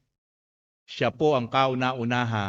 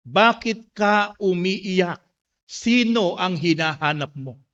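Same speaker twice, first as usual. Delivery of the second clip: slow, raised, emphatic question; drawn-out preacher's question; proclaimed scripture quote; 7 semitones higher, 5 dB louder, slower than usual. Acted. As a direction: declaim it slowly, starting high and loud. Repeats Jesus' question in Tagalog.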